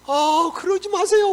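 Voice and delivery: high, loud, slow plea; acted pleading. Servant tries to stop his master, acted loud pleading.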